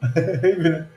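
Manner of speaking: high, breathy giggling laugh; amused laugh. Real, not acted. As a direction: burst into a giggle. Amused at caller's complaint.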